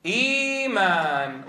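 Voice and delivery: إيمان with the long ii drawn out slowly. Shown as a demonstration. Teacher showing students the long vowel, slow. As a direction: stretch the ii in إيمان. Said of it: "فنقول".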